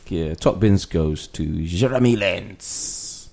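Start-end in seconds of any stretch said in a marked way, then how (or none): 1.6-3.3 mock French flourish on the name; French accent. Host announcing the top bins winner, in a French accent. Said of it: "why he put French accent".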